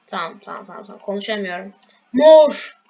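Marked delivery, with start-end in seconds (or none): 2.1-2.8 shout. Real, not acted. Shouting color to teammates.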